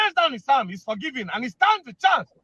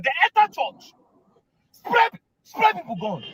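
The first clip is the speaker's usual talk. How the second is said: high outraged slow lament; 9 semitones higher than usual. Real, not acted. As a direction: cry out against the killings.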